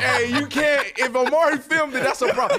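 high, loud, laughing protest; laughing call-out. Real, not acted. Scolding Omari for filming, loud and laughing. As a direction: protest while cracking up.

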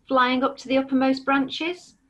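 usual speaking voice

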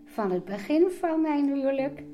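usual speaking voice

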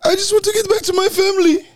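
mock sobbing plea. Host mimics the fugitive's fake sobs.

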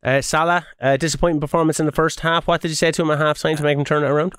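low, quick post-match reporter; TV interviewer voice. Acted.

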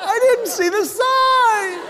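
high, drawn-out mock scream; panicked driver. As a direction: wail in hammed-up panic behind the wheel. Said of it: "Who is driving going".

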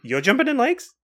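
genuinely can't believe it; surprised. Real, not acted. Co-host amazed the other loves lakes, surprised.

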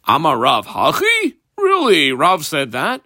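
as if you can't believe your ears; surprised question. Acted. Rabbi Yochanan reacting to Rav's ruling, incredulous. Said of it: "why are you so surprised?".

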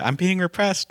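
high quoted line. Retold or imitated. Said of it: "a funny thing about the".

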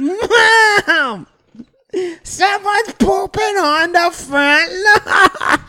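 high slow yell to mom; shrill-pitched kid shout, drawn out; child yelling. Retold or imitated. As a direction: call out like a shocked kid.

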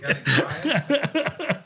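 high, helpless giggle; laugh. Real, not acted. Guest teased by a buddy, high laughing. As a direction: burst into high-pitched laughter.

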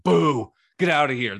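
loud, high jeering boo; mock fan boo. Mocking Miami fans booing Manny Diaz, loud high jeer.

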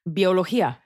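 Biología said in Spanish, bee-oh-loh-HEE-ah; Spanish word. Shown as a demonstration. Proving the prefix exists in Spanish, Spanish pronunciation. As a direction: roll out Biología with a Spanish accent. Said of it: "is the prefix bi used in Spanish?".

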